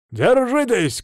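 high, urgent call; shouted warning. A narrator's reading. Driver warns passengers as he speeds up, shouting. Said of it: "крикнул водила".

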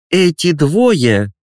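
slow, high accusing headmistress; prosecutorial tone. Acted. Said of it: "сказала директор прокурорским тоном".

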